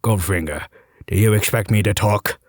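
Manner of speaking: low Scottish Bond voice, Goldfinger, do you expect me to talk; a groggy patient doing his best Connery at the dentist; Sean Connery impression. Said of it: "said to my best Connery".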